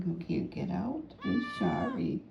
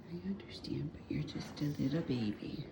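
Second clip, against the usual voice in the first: soft whispered apology to a kitten; hushed comforting sorry; 8 dB quieter than usual. Real, not acted. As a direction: whisper it gently to the cat. Soothing the kitten softly.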